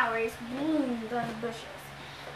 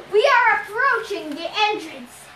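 loud, raised, gravelly announcement; gravelly captain; 6 semitones higher, 6 dB louder than usual. A narrator's reading. Ghostly captain announces the ship's approach, gravelly and loud. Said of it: "The captain's gravely voice".

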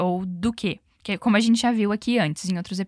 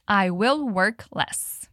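"I will work less" spoken very slowly and evenly; slow model sentence; 2 semitones lower, much slower than usual. Shown as a demonstration. Teacher models "I will work less" for students, very slow. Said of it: "One more time".

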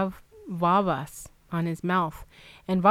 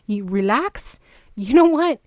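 retold outrage, jumping high and loud; indignant retort; 3 semitones higher, 4 dB louder than usual. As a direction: throw it back at the nurse, affronted. Acted angry retort to calming nurse.

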